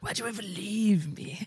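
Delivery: whispery mock-creepy plea; clingy ex, half-whispered; creepy pleading. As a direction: imitate a creep in a hushed whisper. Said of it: "creepy and being like".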